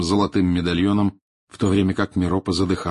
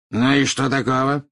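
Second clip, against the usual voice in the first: raised, challenging question; defiant; 6 semitones higher, 4 dB louder than usual. A narrator's reading. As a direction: ask it as a challenge. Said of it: "с вызовом спросил Мракс".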